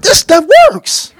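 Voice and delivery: high, laughing delight; amazed laughing. Retold or imitated. Prisoner delighted that prayer works, laughing high. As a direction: laugh it out in wonder. Amazed his faith worked.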